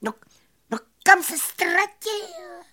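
very high squeaky puzzlement; flabbergasted cricket. A narrator's reading. Cricket searching for the vanished magician, high astonished squeak. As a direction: squeak the question in amazement. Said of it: "vyjevil se Cvrček".

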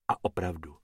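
usual speaking voice

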